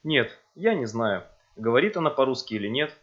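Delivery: ordinary delivery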